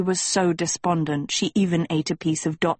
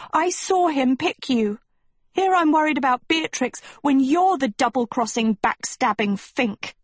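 high-pitched, indignant accusation; accusing hiss; 7 semitones higher than usual. A narrator's reading. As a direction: hiss it at a friend who betrayed you. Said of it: "Sophie hissed".

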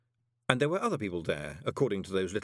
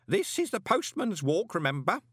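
put-on high, insistent old postman; urging; 10 semitones higher, 3 dB louder than usual. A narrator's reading. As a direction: remind him keenly at his side. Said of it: "Groat urged".

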